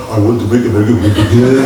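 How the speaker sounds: a light Trump impression; mock the politician's boast; hint of Trump; imitating Trump. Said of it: "Donald Trump ngomongnya".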